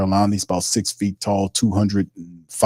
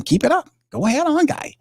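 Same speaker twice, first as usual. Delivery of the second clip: taunting. Retold or imitated. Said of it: "I, like, told him".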